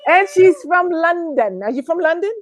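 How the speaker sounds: put-on amateur London accent, lifted pitch; put-on British accent. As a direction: slowly put on a bad British voice. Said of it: "try to use your London, your British accent".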